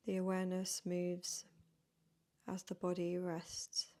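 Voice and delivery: soft, unhurried yoga nidra voice with long pauses; guided meditation. Real, not acted. Yoga teacher leading beginners into rest before bed, guided meditation. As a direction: gently guide them into stillness, phrase by phrase.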